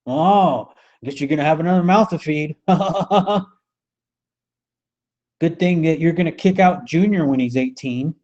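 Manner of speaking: hammed-up lazy slang with a chuckle; mock modern slang. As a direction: toss it off like a crude modern guy at the bar. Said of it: "How do they speak today?".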